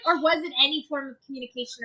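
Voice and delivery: ordinary delivery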